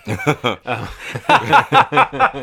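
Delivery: loud burst of laughter; cracking up at the joke; corny dad laugh. Real, not acted. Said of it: "I'm laughing at my own joke".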